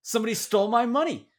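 high, loud mock mom voice, dead earnest; squeaky imitation of mom's blunder; imitating mom. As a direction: play the mother proudly misspeaking. Mom voiced again in story.

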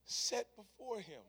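quiet reflective preaching. Acted. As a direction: let each phrase settle quietly. Soft reflective sermon opening.

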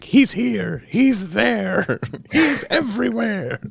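imitating Grover. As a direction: squeak it out like Grover, cracking up. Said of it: "And Grover was like".

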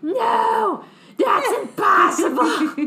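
loud, slow mock Luke wail; Luke Skywalker denial. Luke Skywalker hearing the awful truth, loud slow mock wail.

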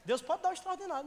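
loud, high-pitched preacher; impassioned, forceful pulpit delivery; fervent preaching. Real, not acted. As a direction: raise the voice to rouse the congregation.

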